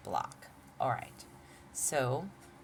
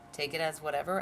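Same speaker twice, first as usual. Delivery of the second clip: ordinary talk, nothing marked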